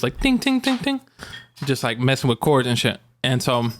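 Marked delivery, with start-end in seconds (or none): 0.2-1.0 high little metallic tings; imitated cat noises. Host mimics the cat messing with cords, high tinging. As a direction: make high, quick tinging sounds. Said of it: "making all these noises".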